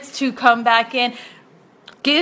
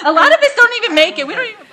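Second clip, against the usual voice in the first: high, fast, louder through laughter; laughing protest; 9 semitones higher, 6 dB louder, much faster than usual. Real, not acted. Host to guest on making own cakes, laughing protest. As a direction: laugh while protesting, pitch way up.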